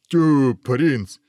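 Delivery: pooh-pooh the newcomer; unimpressed outburst; dismissive exclamation. Acted. Ogre delighted or scornful at prince.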